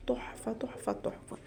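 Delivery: breathy whispered praise. Real, not acted. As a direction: whisper it, savoring the taste. Savoring the taste. (همس بتلذذ)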